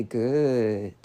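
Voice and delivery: low, drawn-out "good"; низко. Shown as a demonstration. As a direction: let good sink low and stretch it. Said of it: "Or you could say".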